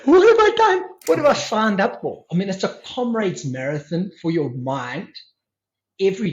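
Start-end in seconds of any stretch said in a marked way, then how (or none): 0.0-2.2 loud, high-pitched incredulous outburst; mock disbelief. Retreat-goer reacting to ten-hour meditation schedule, mock disbelief. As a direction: cry out in comic dismay, pitch leaping.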